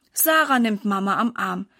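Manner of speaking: plain reading voice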